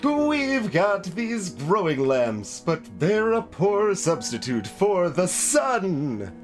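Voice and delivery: talking plant holding forth with stretched words; potted plant voice. Acted. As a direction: declaim as an animated potted plant. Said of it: "what's a voice for a potted plant".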